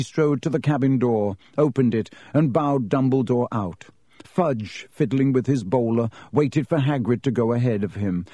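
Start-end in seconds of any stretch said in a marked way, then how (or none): none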